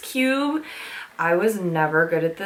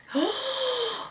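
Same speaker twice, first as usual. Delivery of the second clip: high, soft intake of breath; excited gasp; 5 dB quieter than usual. Real, not acted. So excited by the next item, high gasp. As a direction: gasp softly at a wonderful find.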